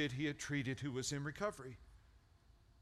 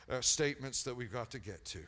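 ordinary talk, nothing marked

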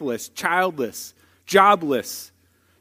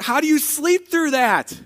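much higher-pitched, amazed question; incredulous; 9 semitones higher than usual. Retold or imitated. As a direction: ask in mock astonishment. Incredulous pastor asks the son.

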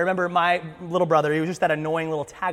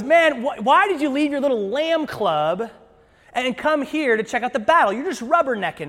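loud, higher-pitched mock exasperation; acted indignant dressing-down; scolding older brother; 7 semitones higher, 6 dB louder than usual. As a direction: berate the kid brother.

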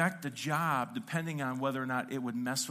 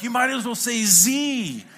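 loud, higher and slow exasperated yell; baffled shout; 7 semitones higher, 11 dB louder than usual. Retold or imitated. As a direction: bellow it back in total confusion. Baffled reply to chord calls.

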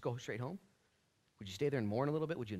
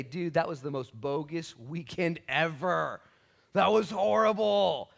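acted dismay; 4 semitones higher, 6 dB louder, slower than usual. Voicing the dejected disciples' complaint.

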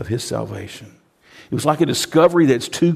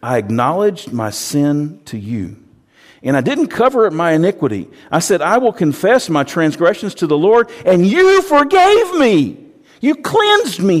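rising to a high, loud rejoicing cry; David bursting out in thanks; jubilant David; 7 semitones higher, 6 dB louder than usual. Acted. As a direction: climb higher and louder into joy. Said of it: "He says".

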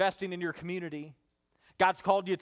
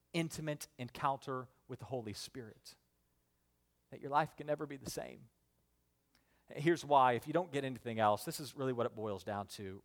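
low, soft, earnest preaching; hushed sermon close; 5 semitones lower, 6 dB quieter than usual. Real, not acted. Pastor sharing his prayer for the church, hushed. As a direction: lower the voice and let pauses sit.

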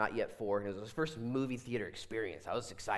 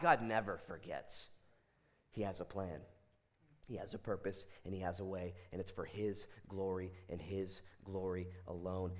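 solemn reassurance; 4 semitones lower than usual. Real, not acted. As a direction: bring it right down and assure them. Reassuring answer after anguished prayer.

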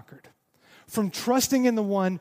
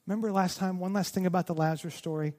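usual speaking voice

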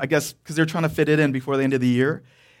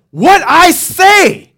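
put-on much higher, louder, very slow roar in Korean accent; full-pitch fury; 13 semitones higher, 11 dB louder, much slower than usual. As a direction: scream it at the top of his lungs. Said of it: "it's like full pitch".

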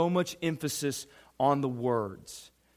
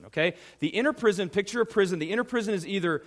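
ordinary talk

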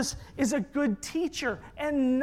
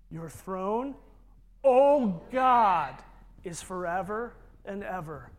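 "O God" set apart and much louder; stress on O GOD; 2 semitones lower, 4 dB louder than usual. Shown as a demonstration. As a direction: hit "O God" hard after a pause, ease off after. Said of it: "Emphasis on purpose".

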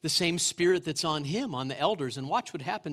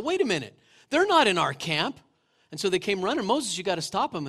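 upset protest; 4 semitones higher, 6 dB louder than usual. Retold or imitated. Preacher voices upset Joshua.

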